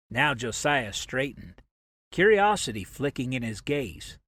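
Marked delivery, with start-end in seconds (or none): none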